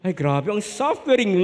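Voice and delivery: normal talking voice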